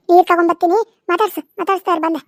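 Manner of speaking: normal talking voice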